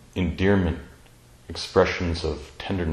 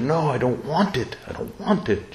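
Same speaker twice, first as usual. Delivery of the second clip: retelling her firm no, a touch higher; emphatic refusal; 7 semitones higher than usual. Retold or imitated. Dying mother refusing food, retold with emphatic refusal. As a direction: insist you don't want it, echoing her. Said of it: "she would say in a very emphatic way".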